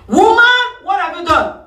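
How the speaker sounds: high called-out "Woman" from God; God calling out. Acted.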